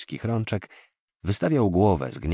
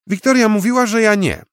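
put-on much higher, louder child, firm and final; a small boy insisting, no argument; firm little boy; 12 dB louder than usual. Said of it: "odpowiedział stanowczo". (mały chłopiec, dużo wyżej, stanowczo)

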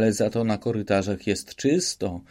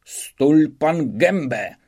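slow, higher and louder bark to shut up; yelled order; 5 semitones higher, 4 dB louder, much slower than usual. Acted. Police councillor yelling at Szwejk to shut up, slow angry yell. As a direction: drag out a furious command. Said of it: "wrzasnął radca policji na Szwejka".

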